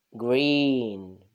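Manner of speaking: ordinary delivery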